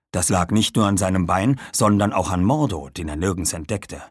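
plain reading voice

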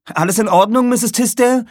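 loud, high worried call. Acted. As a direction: shout the question up the stairs. Calling to the old woman. (besorgt rufend)

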